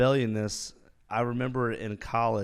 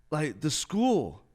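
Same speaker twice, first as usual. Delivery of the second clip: unsure cadet; 6 semitones higher, 3 dB louder, much slower than usual. Retold or imitated.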